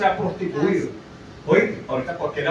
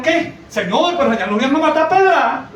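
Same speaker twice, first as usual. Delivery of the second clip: alarmed protest; 7 semitones higher, 4 dB louder than usual. Retold or imitated. Disciples object to going back.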